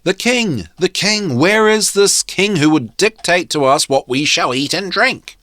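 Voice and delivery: high, loud mock crowd shout; shouting mob. A narrator's reading. Mob under the window shouting for the king. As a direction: call it up like an angry crowd. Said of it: "a vast mob of people all shouting".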